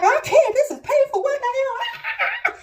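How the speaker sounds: high, loud, laughing cry of pain; mother in labour protesting through pain. Retold or imitated.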